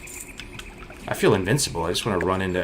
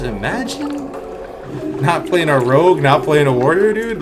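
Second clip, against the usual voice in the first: mocking; 6 semitones higher, 4 dB louder than usual. Teasing other classes.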